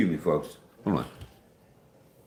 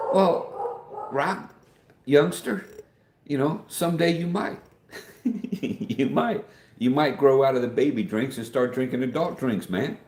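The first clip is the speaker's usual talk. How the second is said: mock teasing; 8 semitones higher than usual. Teasing viewer about coffee.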